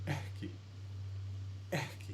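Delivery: ekki with a breath before the kk; pre-aspirated k. Shown as a demonstration. Teacher showing the breathy k in ekki, pre-aspirated. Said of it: "taking a little breath before".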